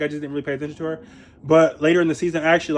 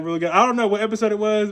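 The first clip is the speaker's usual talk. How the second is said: normal talking voice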